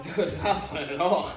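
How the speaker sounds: laughing, high and loud protest. Real, not acted. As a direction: laugh through the words.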